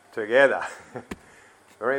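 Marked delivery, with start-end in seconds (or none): 0.1-0.6 "Together" said loud, with a falling finish; emphatic key word. Shown as a demonstration. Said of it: "Did I emphasise it right?".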